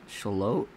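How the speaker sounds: "Shalot" as shuh-LOTE, rising like a question; questioning local pronunciation. Retold or imitated. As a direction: repeat "Shalot" doubtfully, stress on the second syllable. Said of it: "It's, it's Shalot".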